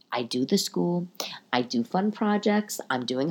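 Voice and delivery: soft unvoiced whisper, slow on the second line; whispered confession. Real, not acted. Listing her efforts, hushed.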